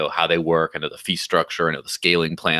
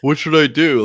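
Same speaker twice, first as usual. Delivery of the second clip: lightly voiced Tone Vei, high and loud; a hint of Tone Vei asking for advice; Tone Vei impression; 9 semitones higher, 6 dB louder than usual. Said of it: "my Tone Vei's and Bernie Sanders imitations".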